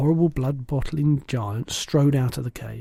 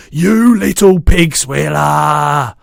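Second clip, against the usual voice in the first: slow, much louder roaring insult; roaring giant; 3 semitones higher, 10 dB louder, much slower than usual. A narrator's reading. Bloodbottler roaring at the BFG, furious. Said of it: "roared the blood bottler".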